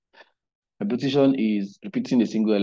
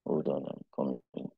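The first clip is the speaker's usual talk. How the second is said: very soft, low talking to oneself; hushed, preoccupied aside with long pauses; distracted muttering; 6 semitones lower, 11 dB quieter than usual. Real, not acted. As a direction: murmur while hunting for a setting. Troubleshooting screen annotation mid-lesson.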